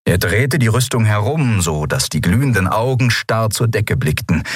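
ordinary delivery, nothing marked

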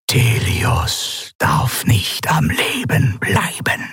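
slow, voiceless alien whisper; eerie whisper. A narrator's reading. Alien governor ordering the admiral in a slow eerie whisper. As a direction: breathe it out slowly like a strange presence. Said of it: "flüsterte Sylravan".

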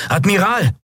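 high-pitched urgent call. A narrator's reading. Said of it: "rief Quailor". (lautes Rufen mit hoher Stimme)

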